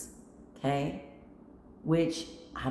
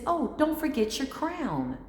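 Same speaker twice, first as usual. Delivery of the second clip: sweet cashier; 9 semitones higher than usual. Retold or imitated. Cashier hands over a crown.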